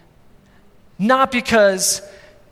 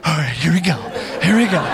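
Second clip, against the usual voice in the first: low mock bracing, breaking into a laugh; bracing himself; 5 semitones lower, faster than usual. Dad readying to catch his charging son, comically bracing. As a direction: steel yourself for impact, half laughing. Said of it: "I just gotta get ready".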